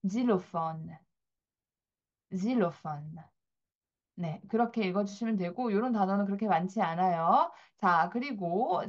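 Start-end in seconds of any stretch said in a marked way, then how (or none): 0.1-3.1 질로폰 with a z sound at the start; 질로폰 as the French word; French xylophone. Shown as a demonstration. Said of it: "이건 이렇게 읽습니다".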